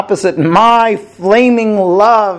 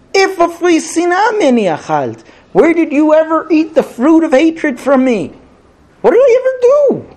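a hurt lover's outcry; indignant protest; 9 semitones higher, faster than usual. Acted. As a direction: voice climbing high in disbelief. Samson protests he never hated her.

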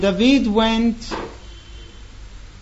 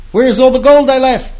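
loud, fast, higher frantic demand; distressed outcry; 5 semitones higher, 8 dB louder, faster than usual. Acted. Weeping widow confronting the man, loud and frantic. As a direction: cry out in panic for the lost gold.

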